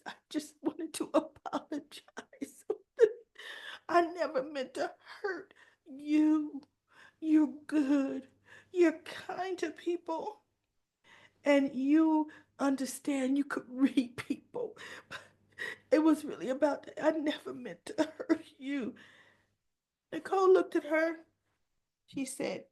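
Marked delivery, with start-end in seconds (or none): 0.1-14.8 high, teary mock apology with a broken laugh; whimpering voice. Leslie apologizing to Nicole in a whimpering voice. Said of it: "drop the whimpering voice". 15.9-18.9 high teary whine with a cracked laugh; whimpering voice. Retold or imitated. Leslie begging Nicole's forgiveness in a mock whimper. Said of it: "drop the whimpering voice".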